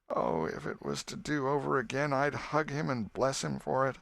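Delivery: high, crying grieving mother. Acted. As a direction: break down in tears. Missus Harper grieving.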